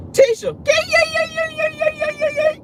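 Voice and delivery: high, hammed-up wailing; high mock sobbing yeah yeah; mock wailing cry. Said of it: "that's how you were crying at the funeral".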